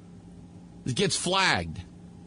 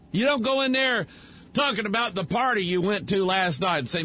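ordinary talk

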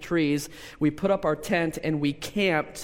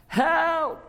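drawn-up high call for help; acted yell; 12 semitones higher than usual. Preacher re-enacts family yelling from the canoe, high mock yell. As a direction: re-enact a yell, pitched far above normal speech. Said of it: "and we yell".

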